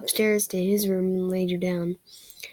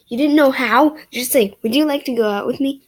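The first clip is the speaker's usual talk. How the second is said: louder, teasing disbelief; playful loud scolding; mock exasperation; 4 semitones higher, 9 dB louder than usual. Real, not acted. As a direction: lecture the shy character. Reader scolds the character.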